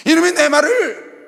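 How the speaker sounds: loud, slow furious outburst; angry God. Retold or imitated. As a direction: thunder it out as an offended God. God voiced angrily in sermon. (진노한 하나님의 큰 외침)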